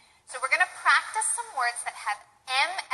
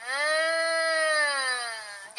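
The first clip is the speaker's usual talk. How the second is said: savoring hum, as if over tasty food; a pleased nasal hum at good food; appreciative mm. Shown as a demonstration. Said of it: "like you saw tasty food".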